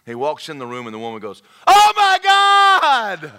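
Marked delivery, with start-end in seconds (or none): 1.7-3.3 huge high comic wail, stretched out; hammed-up cry of terror; panicked scream. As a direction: shriek it in mock horror, dragging the last word. Woman in story panics at seeing pastor.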